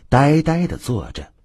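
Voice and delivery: plain reading voice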